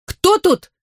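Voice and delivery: high startled cry. Acted. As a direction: shout it out, startled. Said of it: "вскричала юная алхимичка".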